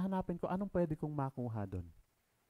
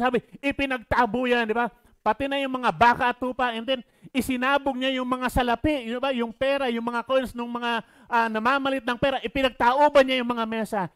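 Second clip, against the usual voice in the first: loud and higher, hammering each action; forceful preaching; 9 semitones higher, 12 dB louder than usual. Acted. Pastor stressing Jesus' rage to listeners, loud.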